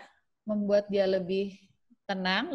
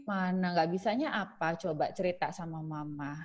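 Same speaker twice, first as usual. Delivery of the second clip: soft reassuring mother; 3 semitones lower, 7 dB quieter than usual. Shown as a demonstration. Mother inviting child to share.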